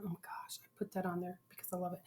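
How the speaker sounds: fast whispered aside; whispering. Real, not acted. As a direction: whisper it quickly to yourself. Whispered aside about accent.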